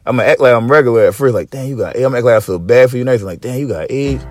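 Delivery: ordinary talk, nothing marked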